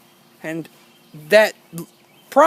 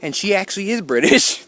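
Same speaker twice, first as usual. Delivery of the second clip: usual speaking voice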